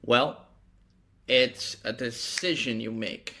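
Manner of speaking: ordinary delivery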